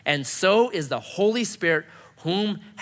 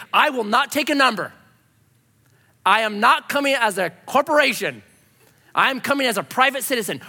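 louder, higher, emphatic proclamation; mock defiant declaration; 5 semitones higher, 4 dB louder than usual. Refusing to take a number at the DMV, mock defiant. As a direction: declare it with mock self-importance.

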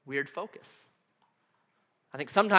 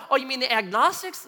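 questioning mom; 6 semitones higher than usual. Retold or imitated. Mom on phone guessing who, high questioning voice.

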